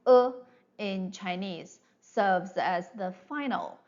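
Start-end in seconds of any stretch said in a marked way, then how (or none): 0.1-0.3 "e" as Mandarin e, back unrounded vowel. Shown as a demonstration. Teacher modelling Chinese final for students, clear Mandarin e. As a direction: say "e" the Chinese way, not English e. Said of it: "pronounced as".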